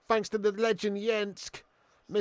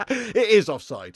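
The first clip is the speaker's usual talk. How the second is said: laughing; 4 dB louder, slower than usual. Real, not acted. Laughing at own mistake.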